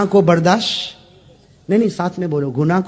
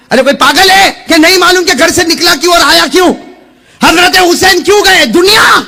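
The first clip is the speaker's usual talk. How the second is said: loud, high-pitched rhetorical questioning; fiery preaching; 10 semitones higher, 7 dB louder than usual. Acted. Preacher challenging his congregation on why Husain went, fiery preaching. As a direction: preach it at a shout, pitched well up.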